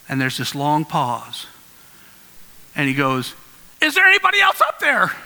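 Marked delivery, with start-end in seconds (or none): 3.8-5.1 high, quick shout upward; desperate calling out. Acted. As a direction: call up for any other rescuer, comically desperate. Man rejects God's answer, calls upward.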